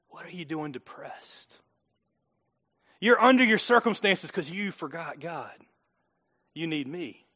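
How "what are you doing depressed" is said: slow hushed question. Shown as a demonstration. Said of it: "Can I say it a little bit different?".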